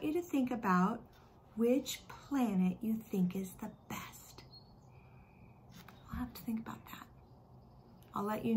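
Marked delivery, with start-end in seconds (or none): 1.6-7.1 soft secret whisper; teacher whispers to her class. Acted. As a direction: whisper it like an invitation.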